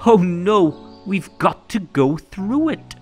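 ordinary delivery

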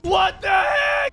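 very high, drawn-out shriek; high stretched outraged yell; shocked scream. Real, not acted. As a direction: scream it in disbelief. Shocked in game.

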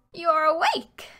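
put-on old woman voice. A narrator's reading. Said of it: "she said when she saw Gretel".

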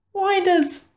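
put-on high, eager little boy; child voice. A narrator's reading. Narrator voicing the boy asking Mum, cut off. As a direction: pitch up as the small boy.

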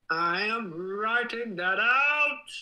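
loud, high, drawn-out mock shout; exaggerated announcing. Streamer making a show of noting it down, hammed-up shout. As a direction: belt it out slowly, rising to the end.

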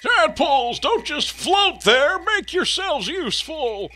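loud, slow orders to the tadpoles; commanding call. Acted. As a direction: boss the little ones around. Frog sage orders the tadpoles.

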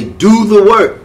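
mock the old teacher's drill; imitating teacher's catchphrase. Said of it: "he would always say".

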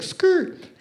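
high-pitched tire squeal. Retold or imitated. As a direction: squeak out a sharp "skrrt". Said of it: "kinda like [name]".

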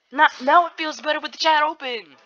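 high, loud and cheerful; excited. Real, not acted. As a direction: announce it brightly. Cheery streamer turns chat on.

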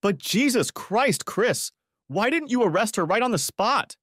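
high-pitched, exasperated disbelief; incredulous. A narrator's reading. Husband asking deputy about no arrest, incredulous. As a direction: question him in amazed protest.

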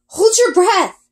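high, loud urgent yell. A narrator's reading. Girl yelling to Ari as they dive, loud. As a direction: shout the warning just before impact. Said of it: "I yell".

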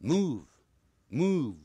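"move" slow and loud, cut off on a voiced "v"; sounded final v. Shown as a demonstration. Teacher modelling the ending for students, careful pronunciation. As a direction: stretch "move" and close it on "v". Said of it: "这样把v念出来".

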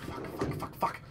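very soft repeated muttering; hushed urgent cursing. Real, not acted. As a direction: curse under your breath, pressed for time. Frantic muttering under time pressure.

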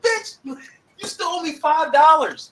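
high, loud, slow accusation; indignant yell. Real, not acted. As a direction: shout the debt at him. Money dispute with roommate.

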